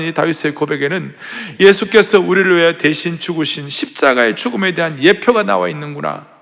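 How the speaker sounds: normal talking voice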